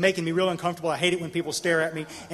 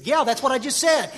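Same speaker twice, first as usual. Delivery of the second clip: higher, irritated retort; 6 semitones higher, 4 dB louder than usual. Retold or imitated. Pressed by a friend's repeated checking, irritated. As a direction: insist testily, as if jammed up. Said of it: "Now I'm getting irritated".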